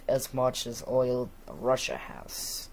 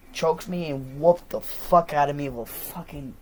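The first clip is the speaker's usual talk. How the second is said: ordinary delivery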